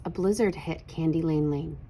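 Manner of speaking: ordinary delivery, nothing marked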